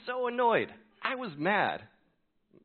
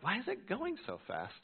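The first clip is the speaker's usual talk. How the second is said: high, baffled question; puzzled; 10 semitones higher than usual. Retold or imitated. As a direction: ask it confused, pitched way up. Puzzled at engine fuel.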